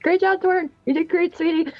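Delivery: high, encouraging call at normal volume; hyped-up cheering. Acted. Velsara the hype person praising Torin mid-fight, high cheering shout-out. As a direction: cheer them on in a high, gushing voice. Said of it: "Velsara is gonna shout".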